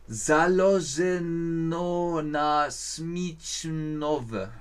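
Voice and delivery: reading a foreign sign syllable by syllable, very slowly; slow sounding-out. Real, not acted. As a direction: spell out the Czech words slowly, unsure. Liest tschechisches Schild vor. (langsames Entziffern eines fremden Schildes)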